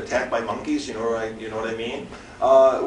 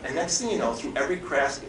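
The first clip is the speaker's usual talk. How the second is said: ordinary talk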